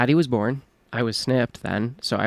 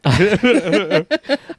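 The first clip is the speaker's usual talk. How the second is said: loud, high burst of laughter; 7 semitones higher, 7 dB louder than usual. Real, not acted. Laughing at the accent bit.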